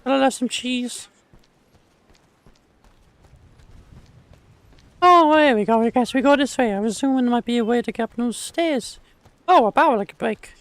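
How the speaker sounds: put-on very high-pitched silly accent. Streamer doing a redeem while gaming, silly high accent. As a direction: put on a silly squeaky voice and hold it. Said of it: "Fucking a silly accent".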